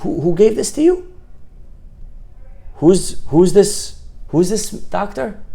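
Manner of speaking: high-pitched, stammering astonishment; amazed. Acted. Doctor amazed at the drawing asks who made it, high astonished questioning. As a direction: ask in rising, high-voiced wonder. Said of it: "He, he's amazed".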